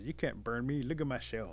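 usual speaking voice

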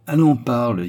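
loud, sneering urging; ricanement impatient. A narrator's reading. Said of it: "ricana Bulba". (presser de parler, fort, en ricanant)

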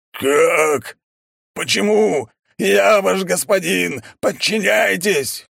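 high, loud desperate wail; shrieking monster. A narrator's reading. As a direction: scream in panic and rage. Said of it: "вопила тварь". (вопль твари)

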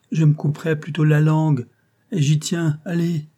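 fervent concierge. Acted. Concierge vows silence to the clerk, lightly voiced and insistent. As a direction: vow it with fierce conviction.

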